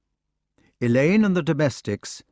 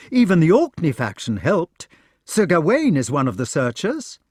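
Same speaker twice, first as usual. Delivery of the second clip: much higher, louder, tumbling out; astonished eager questions; 6 semitones higher, 4 dB louder than usual. Acted. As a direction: press him with amazed questions. Knight still excited.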